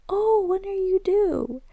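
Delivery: high, slow mock-sweet nosy voice; nosy lady. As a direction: ape a prying lady asking sweetly. Nosy lady asking about pregnancy.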